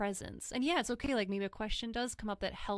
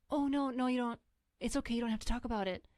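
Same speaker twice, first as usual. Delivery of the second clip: higher, fluttery imitation of a flustered comforter; hasty, over-soothing backpedal; uneasy reassurance; 3 semitones higher, much faster than usual. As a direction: mimic someone nervously shutting it down kindly. Acting out an uncomfortable person's reassurance.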